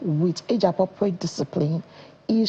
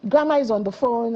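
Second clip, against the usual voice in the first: lightly voiced daughter; 6 semitones higher than usual. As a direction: lightly imitate a child calling out to her mother. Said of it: "she'll pick my phone and say".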